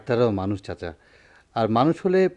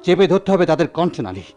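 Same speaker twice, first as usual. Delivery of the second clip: fiery resolve; 3 semitones higher, 4 dB louder than usual. Acted. Doctor vowing to crush the oppressors, fiery and loud. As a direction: rouse him to fight.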